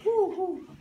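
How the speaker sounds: high hooting owl call. Shown as a demonstration. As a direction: hoot like an owl. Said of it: "that bird, an owl".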